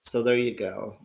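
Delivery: normal talking voice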